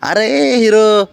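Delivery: slow, loud, high drawn-out अरे hero; teasing the dirty pet; drawn-out mock call. Real, not acted. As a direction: stretch it out teasingly, like calling a show-off.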